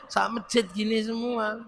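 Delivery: ordinary talk, nothing marked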